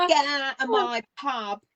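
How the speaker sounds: put-on light playful Cockney accent; gruff Cockney pub landlady, Cockney accent. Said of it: "In your best Cockney accent".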